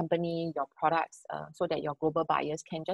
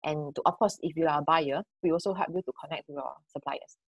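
ordinary talk

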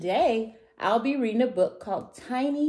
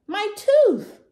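loud, very high wail; hammed-up high cry of pain; 10 dB louder than usual. A narrator's reading. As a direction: wail it like a dinosaur with toothache. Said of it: "he cried".

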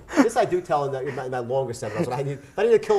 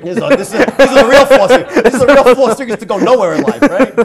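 loud and higher, laughing through it; 7 semitones higher, 13 dB louder than usual. Real, not acted. Host laughing, guest pushes the joke loud and laughing. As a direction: play the punchline up with a laugh. Laughing over the fourth secret.

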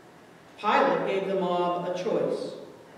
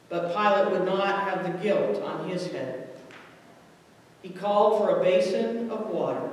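usual speaking voice

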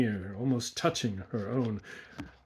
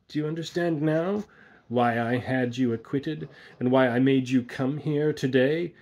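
raised, louder, rising questions; triumphant menace; 4 semitones higher, 6 dB louder than usual. A narrator's reading. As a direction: press each point home, building louder. Villain triumphantly reveals his revenge plan.

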